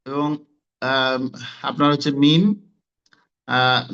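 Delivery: normal talking voice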